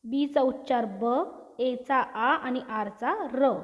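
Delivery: letter sounds stretched slowly, one by one; slow sounding out. Shown as a demonstration. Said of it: "पुढील उदाहरण आहे बार".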